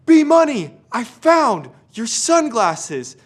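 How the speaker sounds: put-on high, slow, shouted kid voice; a little kid calling out in triumph; excited little cousin. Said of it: "And he goes".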